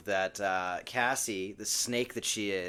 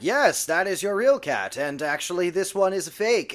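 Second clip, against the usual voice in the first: higher, louder put-on declaration; a hint of an overdone announcement, pitched up; lightly acted explanation; 5 semitones higher, 4 dB louder than usual. [name] owning up to Melissa about the fake cat, lightly hammed-up.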